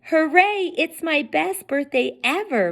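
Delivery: high, excited cheer; cried out happily. A narrator's reading. King Mo cheering about his birthday, high and excited. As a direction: burst out with joy. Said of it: "Mo cried".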